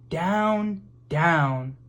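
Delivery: plain reading voice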